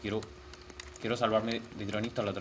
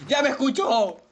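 startled shout; 11 semitones higher, 8 dB louder than usual. Real, not acted. Game monster hears him.

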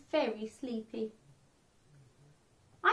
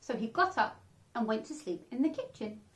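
usual speaking voice

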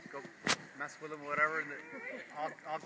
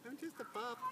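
voicing the puppy; 8 semitones higher, 4 dB quieter than usual. Speaks for the excited dog.

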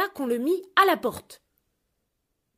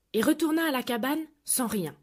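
plain reading voice

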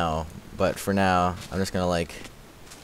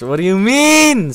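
loud, high, drawn-out question; indignant outburst; 12 semitones higher, 10 dB louder, slower than usual. Real, not acted. Streamer objecting to chat's remark on their voice, indignant. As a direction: protest slowly, pitched way up and loud.

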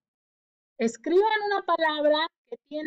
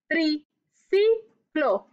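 "Triciclo" slow, each syllable apart; stretched-out word; much slower than usual. Shown as a demonstration. Teacher counting syllables with children, slowly. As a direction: draw out "Triciclo" evenly. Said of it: "¿Cuántas sílabas hay?".

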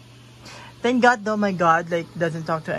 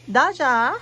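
calling out; 6 semitones higher, 6 dB louder than usual. Real, not acted. Calling her cat by name.

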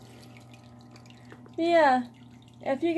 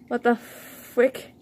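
ordinary delivery

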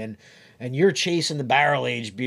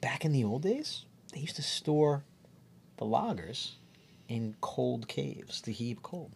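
hushed breathy whisper; 10 dB quieter than usual. Real, not acted. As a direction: lean in and whisper it. Hushed aside about lager caves.

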